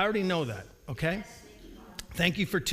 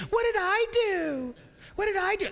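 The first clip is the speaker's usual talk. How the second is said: put-on whiny kid protest; 12 semitones higher than usual. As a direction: whine like a kid caught out. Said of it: "from your kids".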